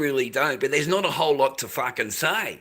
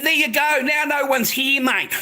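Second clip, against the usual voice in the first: mock taunt; 8 semitones higher than usual. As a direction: goad him round the corner, pitched up. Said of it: "I said".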